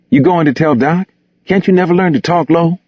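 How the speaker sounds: high-pitched, annoyed character voice; annoyed scolding. Acted. Gus scolds Bigger for loose talk.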